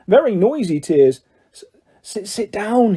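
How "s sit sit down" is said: a lightly wailed, stammering echo; wailing. A narrator's reading. Elf repeating Harry's offer, mildly wailing. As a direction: choke the words out through tears. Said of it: "he wailed".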